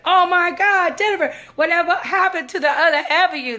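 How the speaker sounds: high, loud gushing amazement; mimicked over-the-top surprise; astonished greeting. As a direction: exclaim as if stunned by someone's new look. Acts out his astonished greeting.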